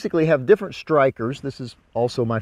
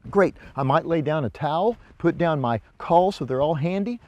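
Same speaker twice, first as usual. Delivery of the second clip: usual speaking voice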